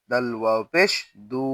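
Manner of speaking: final দু held long; one-alif stretch. Shown as a demonstration. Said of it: "এখানে আমরা এক আলিফ টান দিব".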